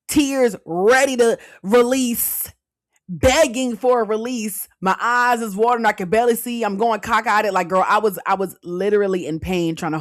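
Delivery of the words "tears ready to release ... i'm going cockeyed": loud, higher, hammed-up strain; dramatic retold struggle. Acts out holding back tears.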